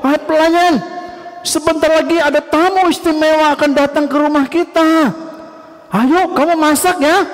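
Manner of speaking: high, eager call to the servants; excited orders. Acted. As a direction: hurry them to cook. (memerintah pelayan dengan bersemangat)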